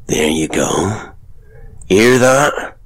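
loud, slow, needling carnival man; sly showman father. Acted. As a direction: lean in and taunt her. Father boasts.

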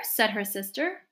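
ordinary delivery

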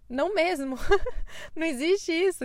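laughing no. Real, not acted. Laughing firm refusal.

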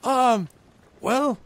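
high, halting little voice; high-pitched wavering over a decision; hesitant goblin ambassador. Acted. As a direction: hedge slowly in a high goblin voice. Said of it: "he kinda looks behind him".

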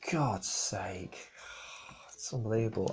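whispered aside, fed up; exasperated whisper. Real, not acted. A podcaster mutters to listeners about Cena's entrances, whispered. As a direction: breathe it out under your breath.

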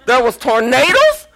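high, loud startled yelp; shocked. Retold or imitated. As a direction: hear the news and yelp in shock. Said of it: "I was like".

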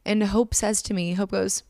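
usual speaking voice

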